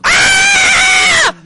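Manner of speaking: long high shriek; loud, sustained high-pitched scream; imitated scream. Stalker shrieking at him in the street, imitated high scream.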